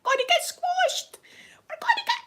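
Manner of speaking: high, whispery mock panic squeal; panicked squeal. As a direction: squeal it like you're about to be crushed. Panicked retold scream in car.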